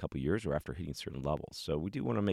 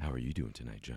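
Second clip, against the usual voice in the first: lower, smooth late night DJ voice; late night radio dj; 6 semitones lower, 5 dB quieter, faster than usual. Pretend. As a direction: croon it like a night radio host. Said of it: "a late night D J voice".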